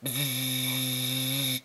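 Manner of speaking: long, steady imitated buzzer; sustained electric-sounding bzzz; doorbell buzz. A narrator's reading. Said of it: "buzzed its terrible warning buzz".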